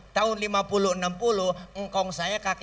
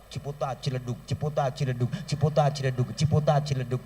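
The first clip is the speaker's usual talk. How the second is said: rhythmic drum-pattern chant; imitating hadroh bass; 5 semitones lower than usual. Shown as a demonstration. Preacher imitating the hadroh bass drummer. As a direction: tap out the bass drum with your voice. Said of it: "Hadroh yang megang bass".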